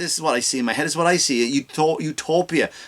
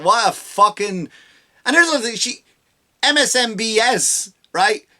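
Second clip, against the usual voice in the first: ordinary talk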